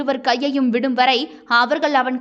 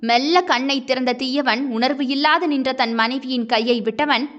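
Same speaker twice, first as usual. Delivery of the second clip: usual speaking voice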